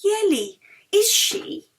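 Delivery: high, excited asking; Mary leaning in, eager for an answer; eager question. A narrator's reading. Mary asks Yeli eagerly, pitched high.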